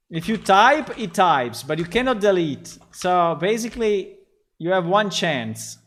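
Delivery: loud, high, exasperated ranting; upset. Real, not acted. Streamer ranting at the store search that won't delete, loud and exasperated. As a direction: vent real frustration at the screen. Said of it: "I'm getting so upset".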